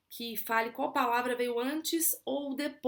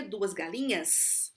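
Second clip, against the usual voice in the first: drawn-out teaching voice on "galinhas"; slow plural demo; 3 semitones lower, much slower than usual. Shown as a demonstration. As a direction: stretch out "duas galinhas" slowly, leaning on the plural s. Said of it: "por exemplo, plural".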